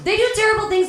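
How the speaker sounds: loud exasperated shout. Acted. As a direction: yell it out in mock outrage. Comedian ranting about children.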